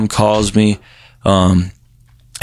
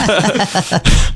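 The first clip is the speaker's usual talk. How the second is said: high laugh; 11 semitones higher than usual. Real, not acted. Laugh recalling the drug days.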